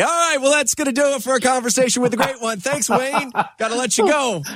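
high, fast mock radio-host sign-off; playful impression of a colleague ending an interview; imitating JD's sign-off. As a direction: rattle it off like a host wrapping up a segment. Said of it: "took the time to say".

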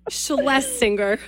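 Schlesinger spelled out slowly as SHLES-ing-er; slow model read of Schlesinger; slow careful Schlesinger. Shown as a demonstration. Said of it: "Can you say it with me then".

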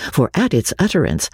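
ordinary delivery

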